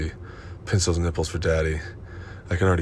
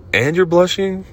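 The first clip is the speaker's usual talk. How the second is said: mock surprise; 12 semitones higher, 6 dB louder than usual. Dominant partner teasing her blushing, mock surprise. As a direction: pitch leaps high in teasing disbelief. Mocking his partner's reaction.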